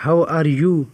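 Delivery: ordinary talk, nothing marked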